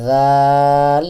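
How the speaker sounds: "দাল" with a soft voiced "dh", long vowel; tongue tip at teeth, stretch "দাল"; very soft articulation. Shown as a demonstration. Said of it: "একেবারেই নরম".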